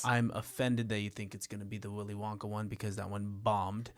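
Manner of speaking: low, soft, slurred celebrity voice; lightly voiced drunk actor; Johnny Depp impression. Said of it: "She has Johnny Depp".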